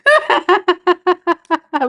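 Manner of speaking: real high giggle; long high laugh; high burst of laughter. Real, not acted. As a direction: crack up for real. Laughing at her own bit.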